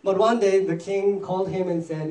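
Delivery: normal talking voice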